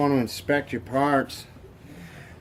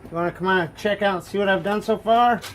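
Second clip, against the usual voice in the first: raised voice calling someone over; yelling across the room; 7 semitones higher, 5 dB louder, faster than usual. Real, not acted. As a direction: call out across the house. Calling wife to come see.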